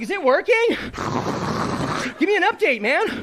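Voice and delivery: eager high-pitched asking; mock anxious high voice; high eager check. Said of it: "I was like".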